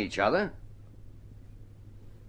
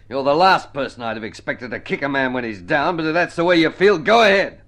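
builds from firm to a loud, high outburst; rising anger; 8 semitones higher, 11 dB louder than usual. Acted. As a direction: let it swell into a bitter yell. Bitter quarrel with his wife.

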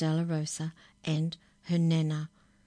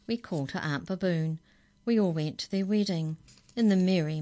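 lively rhyming read-aloud, louder as it builds; reading a funny poem to children; 2 semitones higher, 4 dB louder than usual. A narrator's reading. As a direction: bounce through the rhymes.

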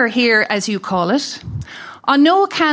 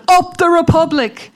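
mock shout; 5 semitones higher, 3 dB louder than usual. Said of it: "or shout".

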